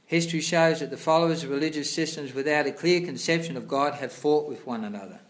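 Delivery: loud, formal reading of scripture; reading aloud. Acted. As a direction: read the passage out like a sermon. Reads scripture purport aloud louder.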